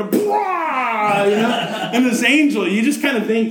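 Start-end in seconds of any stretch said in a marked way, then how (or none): 0.0-1.2 playful boo-like blurt; mock scare shout. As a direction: jump out at them with it. Angel bursting in.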